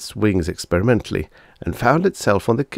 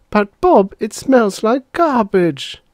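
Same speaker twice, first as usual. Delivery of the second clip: put-on whiny little girl; 5 dB louder, slower than usual. Bonnie complains about smell.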